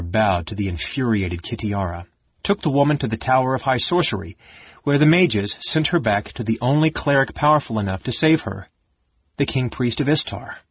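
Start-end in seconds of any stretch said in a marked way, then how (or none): none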